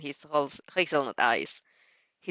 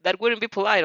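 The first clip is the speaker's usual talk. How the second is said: plain reading voice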